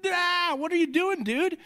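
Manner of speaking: high-pitched mock exasperation; a put-on cry of parental frustration; frustrated dad. Dad imitating himself frustrated by his son's behavior, high mock dismay. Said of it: "I'll be like".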